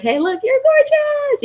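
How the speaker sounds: very high, drawn-out gushing squeal; squealy high voice. Retold or imitated. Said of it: "I was like".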